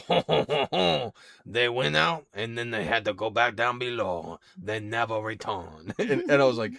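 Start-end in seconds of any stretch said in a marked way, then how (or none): 0.1-1.1 loud, high French "ho ho ho"; hammed-up French chuckle. Shown as a demonstration. Voice actor repeats French warm-up ritual, exaggerated chuckle. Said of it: "'cause I'd be like". 1.4-5.9 French accent. Shown as a demonstration. Voice actor demonstrates audiobook character, exaggerated French accent. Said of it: "to do the French accent".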